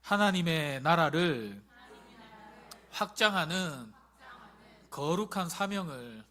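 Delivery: slow, loud, every word weighted; call and repeat. Shown as a demonstration. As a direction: lead the room through it slowly. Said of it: "한번 따라해볼까요?".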